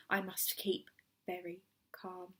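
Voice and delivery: whispered under his breath. A narrator's reading. Mister Hoppy on the stairs whispering to himself. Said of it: "he whispered to himself under his breath".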